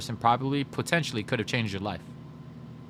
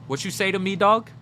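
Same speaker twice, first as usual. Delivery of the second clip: higher and louder by the end, challenging; tough military dad; 7 semitones higher, 5 dB louder than usual. Retold or imitated. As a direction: bark it like a hardcore military dad itching to fight. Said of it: "I get that hardcore military".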